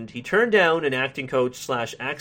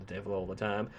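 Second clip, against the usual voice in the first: Southern narrator impression; 5 semitones lower, 13 dB quieter than usual. Repeating the impression despite disapproving listeners, low Southern accent. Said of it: "me doing the impression".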